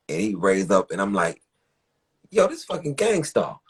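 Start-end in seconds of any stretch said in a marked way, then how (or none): 2.3-3.6 starstruck surprise. Retold or imitated. Recognizing Guru asleep in car.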